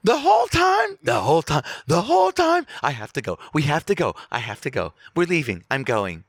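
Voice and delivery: high, urgent mock-distraught voice; hammed-up upset wife impression; Sally Field. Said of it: "Sally Field ready?".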